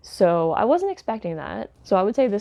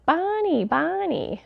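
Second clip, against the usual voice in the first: much higher, drawn-out mock New Hampshire "Bonnie"; mock New Hampshire accent; 12 semitones higher, slower than usual. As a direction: parody her overdone New Hampshire accent on the repeated name. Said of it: "really, really accentuating the way she said these things".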